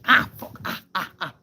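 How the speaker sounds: sudden high yelp of pain, then short ahs; real pained outburst, fading into small cries; burnt-finger yelp. Real, not acted. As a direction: yelp and swear as you touch something hot. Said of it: "Okay, I burnt myself".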